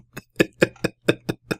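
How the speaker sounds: scornful laugh. Real, not acted. Mocking laugh at guest's claim.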